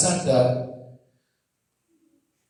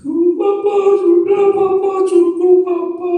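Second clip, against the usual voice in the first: put-on high, loud wailing child's plea; crying child; 11 dB louder, slower than usual. Small child pleading with fighting parents, crying. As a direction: imitate a child wailing at dad to stop. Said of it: "anak saya nangis".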